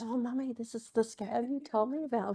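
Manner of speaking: young daughter's voice, softly imitated; hushed little girl pointing something out to Mummy. Young daughter spots the scam on the street, softly voiced. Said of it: "telling my young daughter about this".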